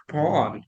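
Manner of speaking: "coupon" as koo-PAWN; speakers' style. Retold or imitated. Learner imitates speakers she heard, stylized pronunciation. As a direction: lean on a long pawn in "coupon". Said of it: "saying this in the style".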